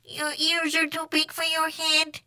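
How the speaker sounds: high monotone alien voice; Martian echo. A narrator's reading. Martian copying Skipito's remark, monotone echo. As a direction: parrot it on one high note, evenly.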